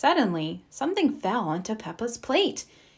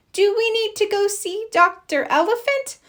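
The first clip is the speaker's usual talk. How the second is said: worried; 11 semitones higher, 4 dB louder than usual. A narrator's reading. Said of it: "Peppa cried".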